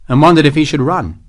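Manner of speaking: usual speaking voice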